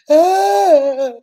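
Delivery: high, loud mock crying howl; hammed-up bawling cry; imitated loud wail. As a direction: wail as if being hit. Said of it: "I was just crying".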